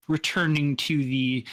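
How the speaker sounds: usual speaking voice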